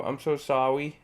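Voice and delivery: "sorry" said as "sawi", r softened to w; baby-talk "sorry" with a w for the r; babyish mispronunciation. Acted. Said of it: "Why are you saying it like that?".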